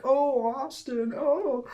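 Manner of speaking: put-on high, drawn-out swoon over a boy's name; breathy admiring sigh of girls in the hallway, slow and high. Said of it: "they're like".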